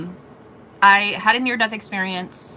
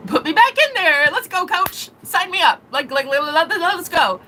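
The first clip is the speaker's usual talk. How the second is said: higher, eager sports-style hype; pumped up; 8 semitones higher than usual. Retold or imitated. Asking the voice to send her back, higher and hyped. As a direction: psych yourself up like a player to the coach.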